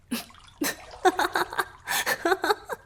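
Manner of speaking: loud bright burst of laughter. Acted. Merman laughing at his own cheeky joke. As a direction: crack up at your own joke.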